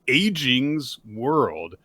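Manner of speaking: ordinary delivery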